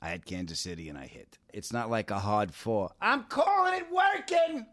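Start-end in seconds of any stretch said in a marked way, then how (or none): 3.0-4.7 high, slow holler; mock mom voice, drawn out and high-pitched; mother's voice. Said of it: "All right, Ma".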